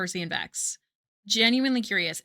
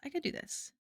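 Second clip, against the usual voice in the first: whispering; 8 semitones higher, 9 dB quieter than usual. Real, not acted. Self pep talk, whispered.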